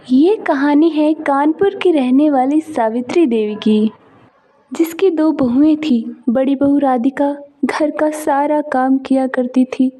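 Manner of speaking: bold, loud storyteller opening the tale; loud story narration. A narrator's reading. Narrator introduces the tale to viewers, loud and bold. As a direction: announce the story loudly to the audience.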